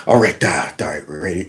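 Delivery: normal talking voice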